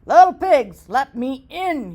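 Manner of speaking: loud growly wolf demand; growling wolf. Acted. Wolf at the brick door, loud and growling. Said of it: "he growled".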